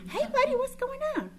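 mock cheerful answer to the son; chirpy phone hello to her son; bright mom greeting. Answering son's call brightly.

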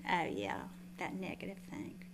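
soft, guilty "oh, yeah" trailing to a whisper; sheepish mumble. Retold or imitated. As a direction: admit it under your breath. Sheepish reply to the Lord.

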